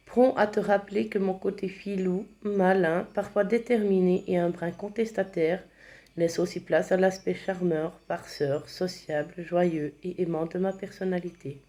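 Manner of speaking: usual speaking voice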